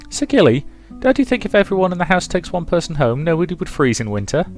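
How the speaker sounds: put-on high, quick little girl voice; bright-eyed child, pitched high and fast; chattering child. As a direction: pester a grown-up eagerly.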